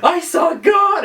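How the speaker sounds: high, slow, shouted announcement; mock shout. Witness posting on the internet, shouting in slow mock awe. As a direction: proclaim it like a wild-eyed witness. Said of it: "be like".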